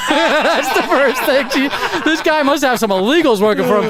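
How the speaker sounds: can't get the words out for laughing. Real, not acted. Retelling the receptionist's wild guess, laughing.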